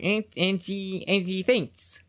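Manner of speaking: high, nasal Australian twang; nasal Aussie cartoon kid. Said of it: "more Australian sounding".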